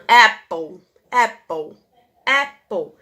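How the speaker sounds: "apple" repeated loud, open "a" vowel; teacher modeling apple's vowel start; repeating model word. Shown as a demonstration. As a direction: drill "apple" for the class. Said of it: "Como tem som de vogal".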